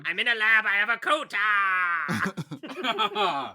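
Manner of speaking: loud, high hammy Ukrainian scientist; fake Ukrainian accent. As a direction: shout it in bad Ukrainian. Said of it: "Your Ukrainian accent is just naming".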